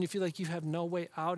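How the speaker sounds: ordinary talk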